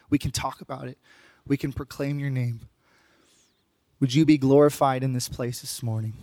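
soft, low-pitched praying voice; prayer. Real, not acted. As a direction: pray quietly and reverently, with pauses between phrases. Leads congregation in prayer.

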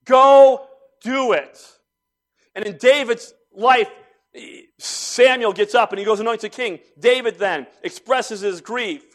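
sermon at full force; full-force preaching. Acted. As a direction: preach it hard to the congregation.

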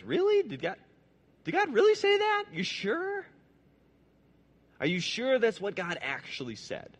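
high, incredulous sneer of the snake; mocking snake. Snake voiced mocking doubt.

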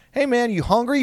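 a lightly voiced nonchalant teacher; mock casual teacher. Teacher cheerfully greeting the rat before smashing it, mock breezy voice.

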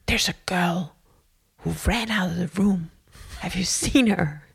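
half-whispered overheard question; hushed, as if heard through a door; retold hushed concern. Lady asking about the runaway girl, hushed half-whisper.

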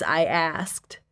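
ordinary delivery, nothing marked

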